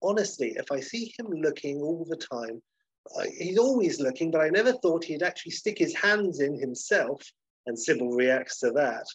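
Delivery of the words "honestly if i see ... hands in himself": put-on scandalised, higher-pitched old lady. Old lady gossiping on the stairs, higher scandalised voice. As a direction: confide it with affronted disbelief. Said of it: "And old lady number one".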